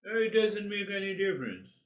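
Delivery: high, light and carefree, brushing it off; breezy shrug-off. Shown as a demonstration. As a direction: toss it away like it's nothing. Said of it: "Let us play".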